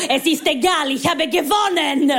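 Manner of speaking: high, emphatic victory cry; triumphant mother. Retold or imitated. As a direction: declare it in mock triumph, stretching the last word. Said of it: "Sie so".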